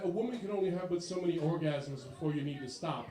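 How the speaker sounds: low, formal son voice; stiff nerdy son. Retold or imitated. Son calling his dad, low and overly proper.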